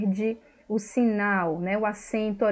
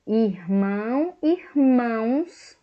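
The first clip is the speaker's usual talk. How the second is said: slow emphatic demo; 4 dB louder, much slower than usual. Shown as a demonstration. Teacher showing the plural form, slow and raised. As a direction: call out irmãos slowly with a rise. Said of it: "o plural, né?".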